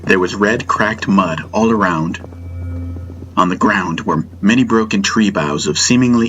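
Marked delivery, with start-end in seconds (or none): none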